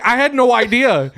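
high-pitched plea of innocence; imitating a defensive friend; retold protest. Companion's protest acted out.

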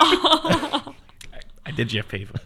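high slow laughing quote. Retold or imitated. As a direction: drawl it out laughing.

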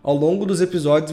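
usual speaking voice

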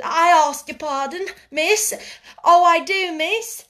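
high, loud frightened pleading; stuttering panic. A narrator's reading. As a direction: beg pardon in a breathless fluster. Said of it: "she stuttered".

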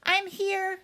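high, weak little voice; thin, high, feeble reply. A narrator's reading. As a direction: answer feebly from under the rubble. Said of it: "said a weak voice".

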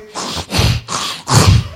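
series of harsh snorts; angry snort. Shown as a demonstration. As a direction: demonstrate snorting with anger, no voice. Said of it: "You know what snort is?".

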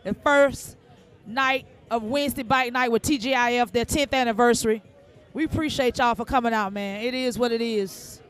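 low, even announcer shout-outs; steady radio host read. Acted. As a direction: roll through the shout-out list like a DJ. Reads a run of shout-outs.

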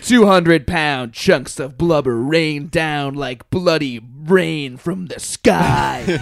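slow, drawn-out mock narrator; video narrator. Joking about the exploding whale video, mock narrator voice. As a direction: stretch it out like dramatic TV narration. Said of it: "the narrator guy's like".

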